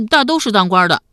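put-on loud, boyish blurt; youngster's forthright voice; frank young nephew. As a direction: answer bluntly like an artless lad. Frank boy answers the emperor.

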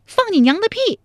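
high, loud angry shout; furious curse. Acted. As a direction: spit the curse at him. Angry woman curses fiancé. (怒骂)

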